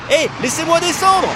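high, loud panicked cry; panicked shout. Acted. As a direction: scream to get off. Scared narrator on plane.

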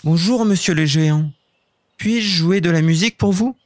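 high, sweetly polite little voice; butter up the giant gently; polite elf. Acted. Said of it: "Il dit poliment".

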